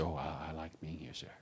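very soft, low, hesitant answer; cowed little reply, imitated; meek schoolboy. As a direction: answer the master timidly, barely audible. Acts cowed boy answering master.

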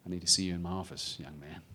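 low, quiet, ominous summons; imitated stern master, voice dropped; hostel master. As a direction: summon a pupil with hushed authority. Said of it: "here comes the hostel master".

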